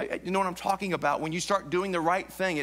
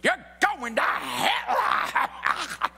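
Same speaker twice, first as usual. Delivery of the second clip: mock yelling preacher; 5 semitones higher, much slower than usual. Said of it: "people yell at you".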